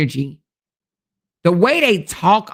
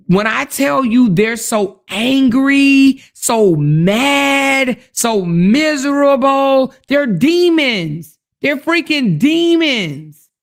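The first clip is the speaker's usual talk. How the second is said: drawing out each word in disgust; heated rant; 5 semitones higher, slower than usual. Real, not acted. Ranting about hateful commenters.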